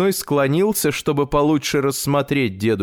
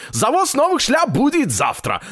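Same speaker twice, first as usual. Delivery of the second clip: high, put-on seller's voice; high rabbit voice; 12 semitones higher than usual. A narrator's reading. Rabbit peddler promises grandfather hats tomorrow, high rabbit voice. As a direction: promise new stock in a squeaky pitch.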